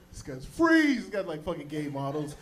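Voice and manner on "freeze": high, loud shout of a cop; barked cop command. Retold or imitated. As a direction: stop the suspect cold. Cop yelling.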